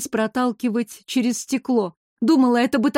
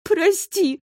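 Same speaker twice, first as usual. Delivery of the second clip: high sobbing apology; a touch of a sob; 8 semitones higher than usual. A narrator's reading. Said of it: "всхлипнув".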